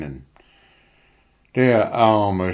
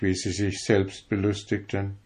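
ordinary delivery, nothing marked

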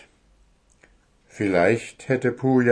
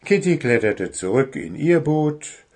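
ordinary delivery

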